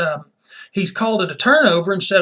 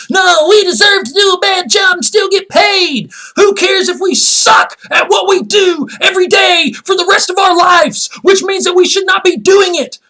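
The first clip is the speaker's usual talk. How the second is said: high, shouted whiny union protest; loud, sustained, high-pitched parody of outrage; mock tantrum; 13 semitones higher, 6 dB louder than usual. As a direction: throw a hammed-up fit as the referees' union. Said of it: "would probably throw a fit about that".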